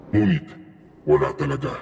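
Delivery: usual speaking voice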